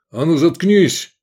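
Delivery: loud, high, drawn-out order to shut up; mock-polite order. A narrator's reading. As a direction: snap the order out forcefully. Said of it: "вежливо попросил даму Лобанов".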